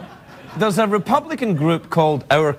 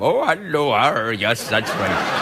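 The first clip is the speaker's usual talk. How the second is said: mixed pirate and Somali accent, dropping low; 6 semitones lower, slower than usual. Shown as a demonstration. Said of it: "I'll just combine the two".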